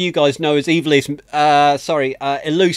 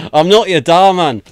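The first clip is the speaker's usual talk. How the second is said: badly faked Scottish accent, louder; terrible Scottish accent; 6 dB louder than usual. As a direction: belt out a hammed-up bad Scottish accent. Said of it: "that was a terrible Scottish accent".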